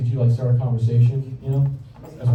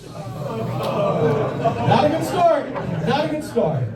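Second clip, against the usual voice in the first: high, drawn-out comic disapproval; high mock warning; 9 semitones higher, much slower than usual. Comedian commenting on dating show contestant's blunder, high mock warning. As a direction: pitch it high in mock dismay.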